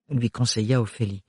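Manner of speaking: ordinary talk, nothing marked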